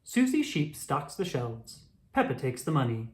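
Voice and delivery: ordinary delivery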